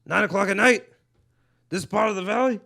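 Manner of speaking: building from talk into a loud, high, laughing rant; Joey Diaz riffing. Retold or imitated. Retelling Joey Diaz roasting an open mic crowd, loud laughing rant. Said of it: "That's a good impression".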